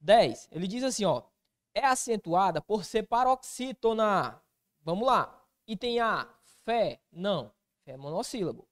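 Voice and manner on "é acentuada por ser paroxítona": ordinary talk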